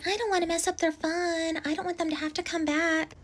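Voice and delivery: high mock-innocent voice; mocking the bride. Imitating the bride refusing to call the guys, high mock voice. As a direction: whine it like she's too nice to bother them.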